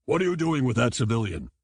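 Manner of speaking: gruff captain demanding answers; stern shark captain. A narrator's reading. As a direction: challenge the soldier. Shark captain questioning soldier.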